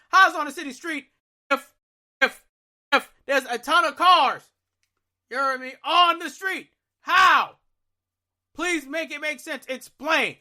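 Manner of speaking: high, loud, incredulous demand; exasperated outrage. Retold or imitated. He rages in his head at the operator's claim. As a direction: fire the question off in disbelief. Ranting about the car being towed.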